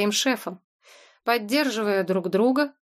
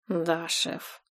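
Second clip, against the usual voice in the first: soft, defeated answer; cowed reply; 3 semitones lower, 5 dB quieter than usual. A narrator's reading. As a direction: answer quietly, beaten. Beaten guard answers.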